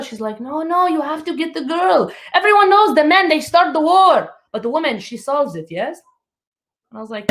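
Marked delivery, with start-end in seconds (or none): 0.4-6.0 lightly voiced insistent mom, higher; imitating mom. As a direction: mom lecturing her daughter, pitched up.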